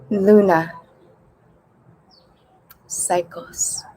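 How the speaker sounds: measured meditation voice with long pauses; unhurried breathing instructions, spaced out; guided meditation. Real, not acted. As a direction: guide a group gently through breathing, leaving space between phrases. Leads breathing before guided meditation.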